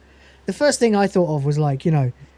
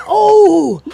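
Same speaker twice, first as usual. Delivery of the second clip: loud, high, drawn-out gasp of offence; hammed-up scandalized cry; offended shriek; 14 semitones higher, 10 dB louder than usual. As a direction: howl "oh" in mock outrage. Vending machine reacts to insult.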